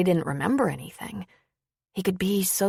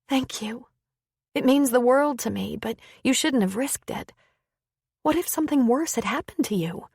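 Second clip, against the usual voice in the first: lightly voiced woman, higher, concerned; a woman pleading gently, higher pitched; worried woman; 6 semitones higher than usual. Lin Sue worries over him.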